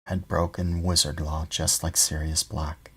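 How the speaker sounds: plain reading voice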